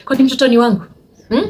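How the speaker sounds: mock a snappy, challenging question; imitated snapping. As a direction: act out someone snapping back. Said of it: "she snapped".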